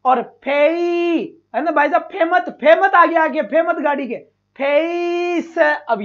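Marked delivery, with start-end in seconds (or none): 0.0-1.4 फे ई drawn out slowly; फे ई stretched slowly; slow syllable demo. Shown as a demonstration. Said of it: "अब आएगा ई". 4.6-5.6 फेस drawn out very slowly, slightly higher; फेस held long; slow demo. Shown as a demonstration. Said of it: "ये बन गया फेस".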